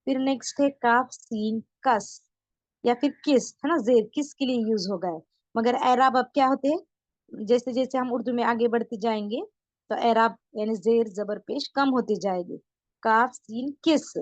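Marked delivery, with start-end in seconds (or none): 0.7-2.2 slow, letter by letter; slow reading for the class. Shown as a demonstration. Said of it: "पढ़ेंगे भी सभी मेरे साथ". 13.0-14.2 slow, letter by letter; slow reading for the class. Shown as a demonstration. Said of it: "पढ़ेंगे भी सभी मेरे साथ".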